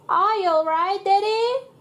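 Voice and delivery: high, anxious little pig; worried child cry. Acted. Pepper calls to Daddy after his fall, worried. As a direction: call out with concern. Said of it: "cries Pepper".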